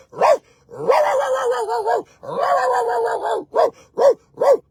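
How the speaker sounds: high yappy mock barking; cat barking. Cat doing high barking sounds. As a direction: yap it out high like a small dog. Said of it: "heard a cat go".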